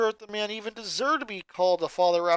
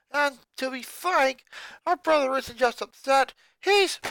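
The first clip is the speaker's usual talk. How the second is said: put-on girl character voice; 7 semitones higher, 3 dB louder than usual. Lincoln's sister pleading to family.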